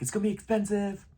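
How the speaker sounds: high, put-on nagging voice; mock scolding relative. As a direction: whine it like a fussing parent. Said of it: "And, like".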